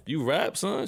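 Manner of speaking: high, mock-amazed question; lightly hammed-up shock; disbelieving. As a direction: act stunned she raps. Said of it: "I'm like".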